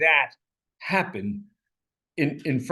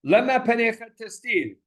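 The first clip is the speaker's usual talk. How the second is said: impassioned plea; 8 semitones higher than usual. Acted. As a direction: rouse God like someone asleep. Voicing the psalmist's plea.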